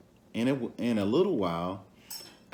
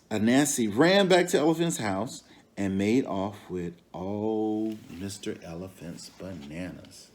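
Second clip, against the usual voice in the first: plain reading voice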